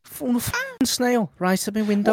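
high-pitched indignant Scouse driver; Scouse accent. Acted. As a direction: complain in disbelief in put-on Scouse. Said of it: "rendition of him getting out of the car".

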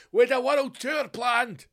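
very slow, high growl; mock raging. [name] raging at Sir [name], slow and high. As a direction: drag the insult out high.

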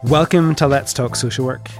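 ordinary delivery

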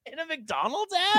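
very high, laughing question; laughing disbelief. Real, not acted. Host hears about an absurd fast-food ad, laughing in disbelief. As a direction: squeak it out through laughter.

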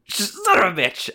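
high, slow laughing curse. Real, not acted. Laughing at own mistake.

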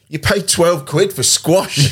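slow, high Northern Irish disbelief; Northern Irish accent. Retold or imitated. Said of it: "really thick Northern Irish accent".

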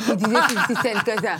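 speaking through laughter; real giggling retelling; laughing. Real, not acted. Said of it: "Quelle saleté".

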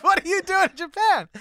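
the agent asking in stunned delight; high, loud astonished question; amazed question. Retold or imitated.

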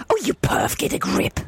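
hushed, breathy telling-off; whispered scold. Acted. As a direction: whisper it sharply.

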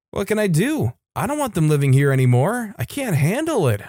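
an understated cry of helplessness, voice lifted. A narrator's reading. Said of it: "I cried".

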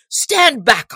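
high, loud sheriff's order; mighty shout. A narrator's reading. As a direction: bark the order at the crowd. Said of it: "cried the sheriff in a mighty voice".

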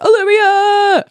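high, drawn-out whoop of the name; playful high-pitched holler; fan cheer. Retold or imitated. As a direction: cheer for your hero, stretched long. Retells cheering for the heroine.

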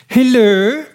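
high, sweet, loud coo. A narrator's reading. Sally greets her lover on the telephone, high sweet coo. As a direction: coo it as if kissing the mouthpiece. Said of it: "she cooed".

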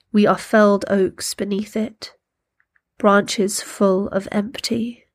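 poem reading. Acted. Reads a poem aloud.

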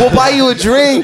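high, loud, laughing offer; laughing appeasement. Retold or imitated. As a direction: placate them through laughter. Nervous offer to attackers.

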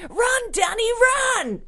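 high playful shout of the nickname; mock yelling. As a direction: yell it like the clip, for fun. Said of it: "nickname his mate ... Dunny".

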